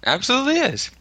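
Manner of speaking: squeaky high mock agreement; mock earnest agreement.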